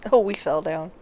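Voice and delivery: usual speaking voice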